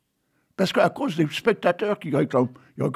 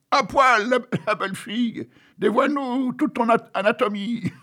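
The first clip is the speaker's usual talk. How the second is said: mock crowd yelling at the stage; crowd shouting; 7 semitones higher than usual. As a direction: bawl it like a rowdy crowd. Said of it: "les voilà qui crient".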